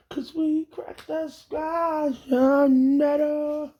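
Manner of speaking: booming prophecy. Pretend. Dramatic pronouncement of God's name.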